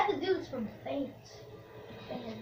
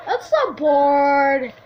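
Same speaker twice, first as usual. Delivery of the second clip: bored whine; 6 dB louder, much slower than usual. Real, not acted. Loud drawn-out complaint.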